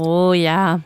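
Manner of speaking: ordinary delivery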